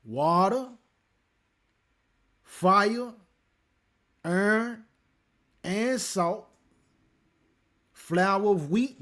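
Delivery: loud, high, one item at a time; preacher ringing out a verse list; emphatic scripture reading. Acted. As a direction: proclaim each item of the list separately. Reads scripture list aloud.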